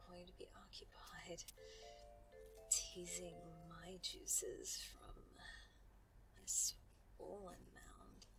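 slow, hushed whisper; soft, unhurried murmur on the breath; breathy whisper. Acted. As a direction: whisper it slowly and intimately. Intimate scene before a phone call.

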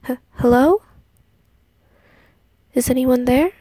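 startled call into the dark; nervous call. A narrator's reading. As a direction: call out, voice catching. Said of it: "called out".

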